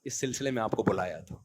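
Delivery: soft, composed dismissal, imitating the teacher; very calm. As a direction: politely deliver the firing without any heat. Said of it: "بڑے آرام سے کہتے ہیں". (بہت آرام سے، نرم لہجے میں برخاستگی)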